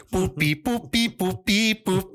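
high playful boop beep sounds; beatboxing beat. Retold or imitated. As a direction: mimic the beat with your mouth. Imitating the song's beat.

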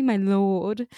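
"my lord" in amateur British, no American r; British accent. Shown as a demonstration. Showing co-host the proper version, attempted British accent. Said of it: "my British accent is awful".

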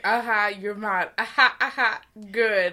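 loud smug aha, gloating; smug mock acknowledgment. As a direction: gloat over them, pleased with yourself. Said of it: "Being like".

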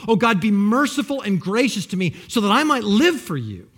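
loud, driving prayer with a punch on each name; fervent preaching. Acted. Preacher cries out to God for his name's sake, loud and fervent. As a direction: cry it out to God from the pulpit.

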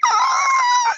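very high screech; tire squeal imitation. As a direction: mimic skidding tires with a sustained squeal. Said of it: "the squealing tires".

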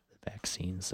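soft unvoiced whisper. Shown as a demonstration. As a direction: breathe it out in a whisper. Whispered repeat of band name.

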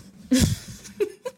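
a loud burst of laughter. Real, not acted. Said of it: "that was probably really loud".

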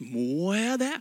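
a grudging question with a rising end; reluctant question. Retold or imitated. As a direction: mimic someone balking at a challenge. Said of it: "Når vi blir utfordret på noe nytt". (motvillig spørsmål)

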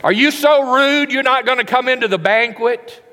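mock-furious yell ordering him inside; harsh scolding. Shown as a demonstration. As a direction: berate him like a fool. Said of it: "Is that what he said? Mm-mm".

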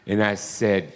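usual speaking voice

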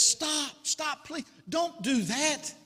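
high-pitched acted plea; storyteller voicing an alarmed rescuer; urgent pleading. Man running to stop a bridge jumper, urgent high plea.

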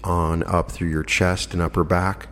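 calm relaxation lead with long pauses; guided meditation. Acted. Host talking listeners into letting go of tension, guided meditation voice. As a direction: guide them gently into rest, unhurried and slightly softened.

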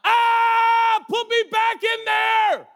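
put-on high shouted baby wail; a newborn begging to go back in. Preacher voicing a newborn protesting its birth, high wailing shout. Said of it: "They come out going".

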